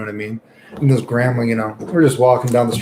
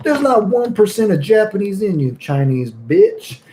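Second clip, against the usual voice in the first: slow retort; 8 semitones higher than usual. Retold or imitated. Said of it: "my friend was like".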